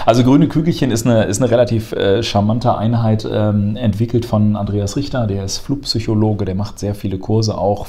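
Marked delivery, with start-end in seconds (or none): none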